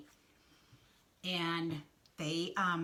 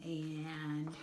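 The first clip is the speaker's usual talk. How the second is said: plain reading voice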